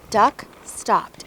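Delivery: ordinary delivery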